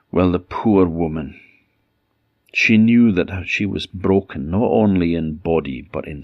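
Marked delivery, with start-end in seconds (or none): none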